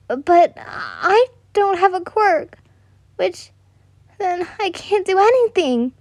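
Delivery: put-on high, slow, tearful little girl; a small girl about to cry; crying child. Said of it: "You started to cry".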